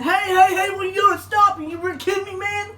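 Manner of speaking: angry neighbor. Retold or imitated. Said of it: "the guy said".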